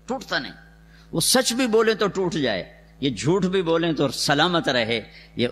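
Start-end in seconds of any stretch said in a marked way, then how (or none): 0.1-0.5 ٹوٹتا نہیں dropped soft and falling; quiet repeat. Shown as a demonstration. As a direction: let ٹوٹتا fall softly. (ٹوٹتا نہیں دھیمی آواز میں)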